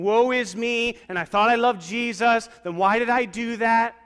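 mock self-pity. Pastor mimicking a sulking believer, high and loud.

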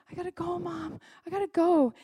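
high-pitched kid imitation; pleading child. Ten-year-old begging mom for the bathroom, urgent high plea. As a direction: plead like a desperate little kid.

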